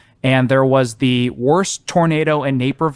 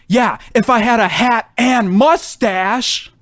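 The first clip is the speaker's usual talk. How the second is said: higher, slow retort shouted back; mock shout; 9 semitones higher, slower than usual. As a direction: fling the jab across the bar, higher. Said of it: "and shouts".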